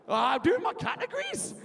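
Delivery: high-pitched mock Geordie; mock Geordie accent. Mocking Alex's revision categories, high Geordie accent. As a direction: mimic in a squeaky Geordie lilt. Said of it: "you, you Geordie?".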